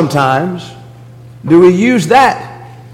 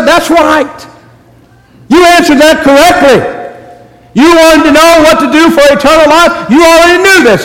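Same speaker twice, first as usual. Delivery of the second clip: higher, brighter voice for Jesus; Jesus voiced; 7 semitones higher than usual. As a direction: speak as Jesus affirming the lawyer. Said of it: "And Jesus said".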